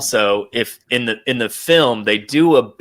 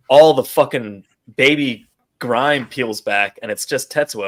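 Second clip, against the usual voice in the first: ordinary delivery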